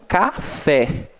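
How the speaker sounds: café slowly split ca-FÉ; stress on last syllable. Shown as a demonstration. Teacher gives another example, slow ca-FÉ. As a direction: put the weight on FÉ in café. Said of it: "pronunciei um pouco mais forte o fé".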